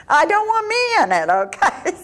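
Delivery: high, fast wry joke; high joking voice. Real, not acted. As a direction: toss it off with a laugh. Joking about avoiding church.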